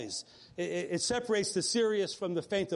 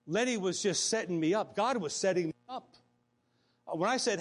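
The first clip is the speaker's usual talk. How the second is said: normal talking voice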